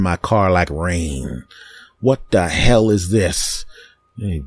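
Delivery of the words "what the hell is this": normal talking voice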